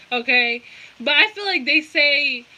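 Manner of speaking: usual speaking voice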